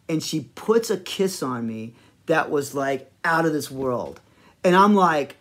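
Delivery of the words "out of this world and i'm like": ordinary talk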